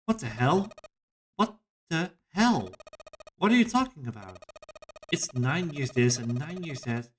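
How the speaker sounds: high-pitched, indignant character voice; exasperated Lotus. Acted. Lotus confronts the others about nine years, exasperated. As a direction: pitch it up and demand answers.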